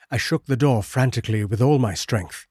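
plain reading voice

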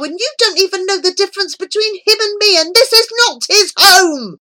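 high, loud, indignant protest; jealous outburst. Acted. Boy protesting jealously to his grandfather, high indignant outburst. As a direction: burst out in wounded, high-pitched anger.

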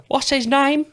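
very high Cockney impression. Repeating the singer's off-stage question, exaggerated high Cockney. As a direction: squeak it out in comic Cockney. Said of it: "off stage he's just like".

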